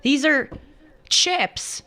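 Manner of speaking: rising high into "chips."; mock insistence. Retelling the chips argument to the co-host, high and mocking. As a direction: mimic yourself correcting the locals. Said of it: "I'm like".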